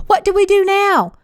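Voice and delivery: high, desperate plea near tears; high tearful cry. A narrator's reading. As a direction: beg for an answer, close to panic. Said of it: "she cried".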